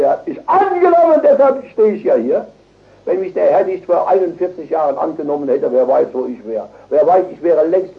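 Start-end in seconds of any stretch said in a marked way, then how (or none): 0.0-2.5 high, loud testimony; jubilant proclamation. Real, not acted. Preacher testifies that he was accepted, jubilant and loud. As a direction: cry it out in triumph.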